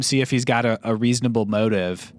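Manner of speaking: ordinary talk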